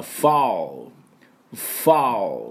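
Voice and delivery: ファウル with slow ファウ; foul with ow. Shown as a demonstration. Teacher modelling ファウル for learners, slow ow. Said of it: "ファウルって言わなきゃいけない".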